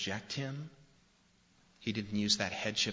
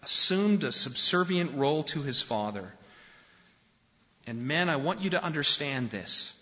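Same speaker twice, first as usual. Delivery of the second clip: full-force preaching; 6 semitones higher, 6 dB louder than usual. Acted. Pastor urging the men in the congregation, forceful preaching. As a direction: drive it home from the pulpit.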